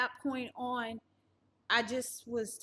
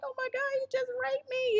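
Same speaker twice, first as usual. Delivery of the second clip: much higher, panicked and tearful; high-pitched distressed cry; retold hysterical crying; 13 semitones higher, 3 dB louder, faster than usual. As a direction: relive the moment in near-hysterics. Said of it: "I'm crying, I'm hysterical".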